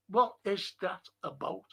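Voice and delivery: usual speaking voice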